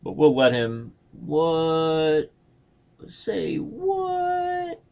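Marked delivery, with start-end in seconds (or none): 1.1-4.8 high, incredulous what; real shocked disbelief; surprised. Real, not acted. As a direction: squeak it in disbelief. Surprised at opponent move.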